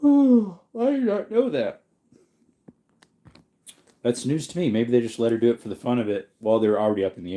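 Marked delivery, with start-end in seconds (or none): 0.0-1.8 sigh, then high and slow, drawn out in fake wonder; theatrical sighing disbelief; mock amazement. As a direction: play up the surprise, slow and high. Repeats fact with mock amazement.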